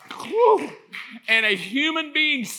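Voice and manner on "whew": high, sudden whew; overwhelmed preacher's whew; exclaimed whew. Real, not acted. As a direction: blow out an awed high whew. Preacher exclaims at his own point.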